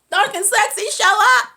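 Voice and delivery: high, loud exclamation; dramatic announcement. Retold or imitated. Storyteller announcing the villain's return, loud exclamation. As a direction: call out his entrance.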